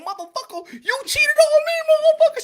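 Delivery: high, loud accusing outburst; imitated angry shriek building in volume; mock arguing woman. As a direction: act out a girlfriend yelling at a cheater. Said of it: "like a real argument".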